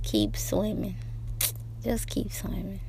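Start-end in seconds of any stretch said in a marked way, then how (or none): 1.8-2.8 soft unvoiced whisper; a tender whispered reminder. Real, not acted. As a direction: breathe the words to yourself. Whispered repeat of the line.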